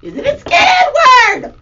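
high, loud scared shout; mock scared. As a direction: act frightened on "scared". Categories voiced.

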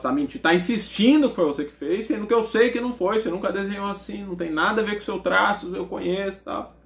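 mildly worked-up scolding, pressing the point; agitated mother. Retold or imitated. Mother accusing son of lying, mildly agitated. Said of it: "Ela começou a ficar nervosa".

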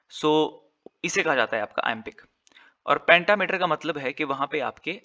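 usual speaking voice

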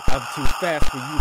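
normal talking voice

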